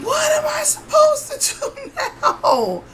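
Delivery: very high, drawn-out exasperated wail; distressed outburst. Retold or imitated. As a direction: wail it in helpless disbelief, slow and high. Retells own distraught outburst to nurse.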